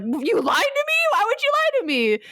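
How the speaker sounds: high-pitched, hammed-up betrayed outrage; Buggy. Buggy confronts Shanks about being lied to, in high mock outrage. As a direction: wail the accusation in mock indignation. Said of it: "where Buggy's like".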